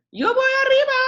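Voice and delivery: very high, loud happy yell; shouting. A narrator's reading. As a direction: yell it out with glee. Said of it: "gritó Ted".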